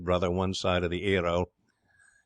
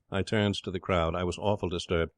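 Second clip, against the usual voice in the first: ordinary delivery, nothing marked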